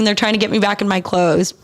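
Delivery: usual speaking voice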